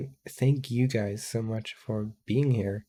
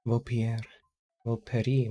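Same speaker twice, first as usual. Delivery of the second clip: usual speaking voice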